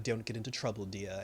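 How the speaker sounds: low, quiet word of warning; stern warning. Retold or imitated. Vision to Wanda before he leaves, stern warning. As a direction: say it low and soft, imitating Vision. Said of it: "looked Wanda dead in the face".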